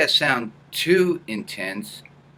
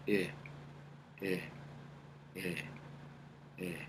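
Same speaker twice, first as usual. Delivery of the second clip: short buzzing eh placed in the lower nose; lower nasal eh; 3 semitones lower, 16 dB quieter than usual. Shown as a demonstration. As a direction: tap out little nasal ehs. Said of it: "make a little sound of a".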